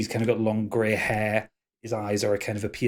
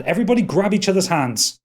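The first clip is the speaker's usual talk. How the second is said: urgent order; 7 semitones higher, 4 dB louder than usual. Acted. Elf orders party amid arrows.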